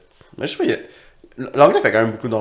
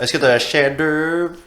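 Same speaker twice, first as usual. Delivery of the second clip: put-on English accent; 5 semitones higher, much slower than usual. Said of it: "avec un accent anglais".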